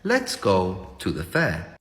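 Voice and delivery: slow low English read; low and slow, very proper English; English accent. Shown as a demonstration. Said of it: "En inglés".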